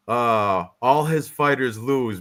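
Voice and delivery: ordinary talk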